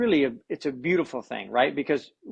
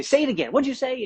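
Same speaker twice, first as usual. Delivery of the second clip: high, louder, delighted prompting; eager coach; 11 semitones higher, 4 dB louder than usual. Retold or imitated. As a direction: egg him on to repeat it, thrilled. Coach eggs player on.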